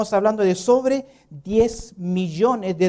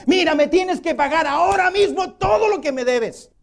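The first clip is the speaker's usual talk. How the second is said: higher, harsh creditor's demand; threatening demand; 7 semitones higher than usual. Acted. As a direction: grab him by the collar and demand it now. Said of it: "lo tomó del cuello y comenzó". (exigencia amenazante y aguda del acreedor)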